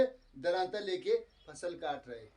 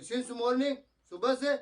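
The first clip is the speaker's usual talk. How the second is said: curt, raised short reply in mock anger; mock angry reply; 5 semitones higher, much slower than usual. Husband answering his wife's question in mock anger. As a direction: snap it back, lightly acted. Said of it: "ऐसे गुस्से से बोला".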